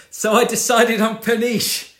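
high, loud mocking parody of the listener; hammed-up imitation of a smug decision; mocking quote.

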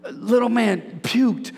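slow, half-whispered reveal; hushed slow punchline; dramatic slow reveal. Real, not acted. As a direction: draw it out for effect. Dramatic revelation of the story.

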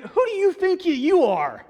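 indignant challenge. Retold or imitated. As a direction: snap back at the upstart. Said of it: "It's like".

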